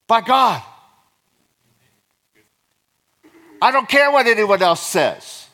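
high, loud, emphatic declaration; excited preaching. Real, not acted. Pastor declaring he is loved by God, excited preaching. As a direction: preach it with real excitement, leaning hard on key phrases. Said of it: "Sorry, I'm getting excited".

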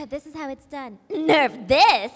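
loud, cocky catchphrase; demanding diva. Acted. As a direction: show them how it's done, loudly. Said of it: "and I was like".

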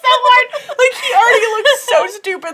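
high, loud words through real laughter; helpless, shouted hilarity; laughing hard. Real, not acted. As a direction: crack up while mocking someone. Cracking up at the imagined scene.

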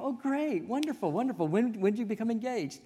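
high, bright-eyed gush of congratulation; mock delighted. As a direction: play up the overjoyed friend, pitched high. Retelling reaction to engagement news.

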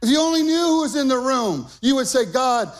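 high, loud, fast build on "if you only knew"; full-force preaching. Acted.

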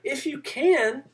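high, slow, disbelieving echo; incredulous. Acted. Jesus echoing the father's doubt, incredulous. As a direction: toss his doubt back at him, amazed. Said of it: "Jesus is almost incredulous".

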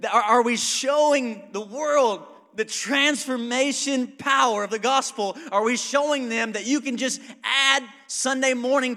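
loud, high, driving pulpit cry; full-force sermon climax; fiery preaching. Acted. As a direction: push the point home to the crowd. Preaching crescendo.

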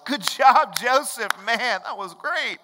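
high, loud, hammed-up congratulations; mock praise. Preacher playing admirers crediting Joseph, loud exaggerated praise. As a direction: cheer him on like an admiring crowd.

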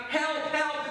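quick high-pitched calls; mock cry for help. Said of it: "heard Jimmy crying out".

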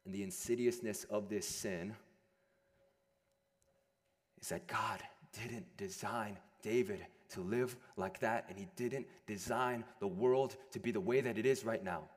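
ordinary talk, nothing marked

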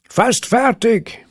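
high, slow call; calling back. A narrator's reading. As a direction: shout it up from the pool. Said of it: "rief Bert".

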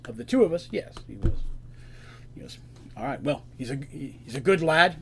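a hint of a gruff elder bull; older Minotaur. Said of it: "this is an older Minotaur".

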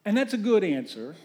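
usual speaking voice

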